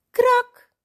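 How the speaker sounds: high, loud "krak"; sudden crack noise. A narrator's reading. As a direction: startle them with a sharp, high crack. Imitated noise from the garden.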